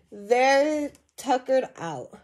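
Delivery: ordinary delivery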